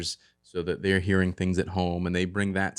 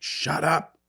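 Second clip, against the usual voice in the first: mock yell; 9 semitones higher than usual. Dad to the barking dogs, mock yell. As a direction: imitate snapping at barking dogs. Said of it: "so I'll yell at the dogs".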